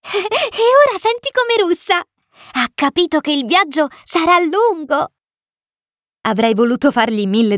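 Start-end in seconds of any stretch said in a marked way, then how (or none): 0.0-5.1 put-on high, delighted child voice; amused little prince. A narrator's reading. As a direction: chatter gleefully in a small high voice. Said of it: "mormorò ridendo". (vocina acuta e divertita)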